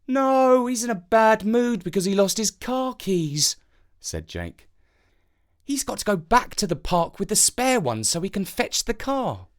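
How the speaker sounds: put-on high boy's voice; a boy telling the crow about his dad, in a high child's voice; young boy. A narrator's reading. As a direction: speak like a small boy explaining. Jake answers the crow.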